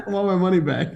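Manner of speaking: laughing as you make a mock complaint; amused, chuckling between the phrases. Real, not acted. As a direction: joke through your own laughter. Joking about his costly teeth.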